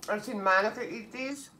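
speaking with mouth partly full; muffled words between chews; chewing food while talking. Real, not acted. Seen 2: Bite taken at -3; lips pursed, chewing through labelled words.

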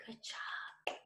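soft whispered praise. Shown as a demonstration. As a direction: keep the hush going. Rainstorm game, quiet phase.

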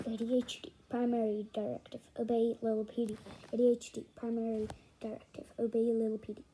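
low, quick monotone recital; droning directive. Acted. 80-HD reciting its primary directive, low quick drone. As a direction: drone it like a programmed machine.

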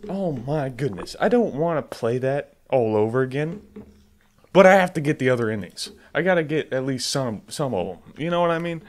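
normal talking voice

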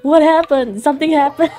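mock worried questions broken by laughter; laughing retold question. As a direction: giggle while asking what happened. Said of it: "I was like".

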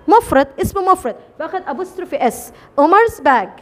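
ordinary delivery

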